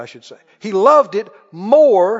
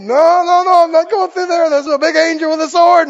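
high, loud comic refusal; acted alarm, loud and high; panicked donkey; 6 semitones higher, 6 dB louder than usual. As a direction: protest like a frightened donkey, hammed-up. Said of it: "And the donkey said".

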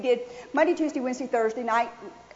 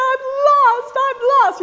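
loud, high-pitched retold wail; mock crying; 10 semitones higher, 8 dB louder than usual. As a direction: imitate yourself wailing in tears, hammed-up. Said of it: "he woke up to me crying".

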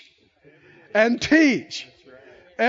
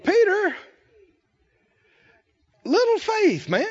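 higher, teasing rebuke, man to man; Jesus scolding Peter; 6 semitones higher, slower than usual. Retold or imitated. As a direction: chide Peter like a buddy, pitched up. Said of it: "what does he say".

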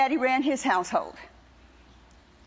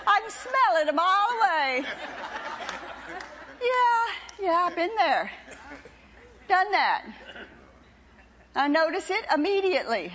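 full-force preaching; 7 semitones higher than usual. Acted. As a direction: drive it home to the church. Preaching full force, after laugh.

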